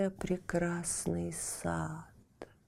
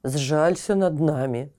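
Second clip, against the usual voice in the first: ordinary delivery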